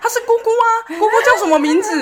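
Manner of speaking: high, loud anxious quizzing; frantic mom quizzing. Retold or imitated. Mom pointing out the aunt to her son, high frantic quizzing. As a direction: quiz him like a desperate mom. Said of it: "她说".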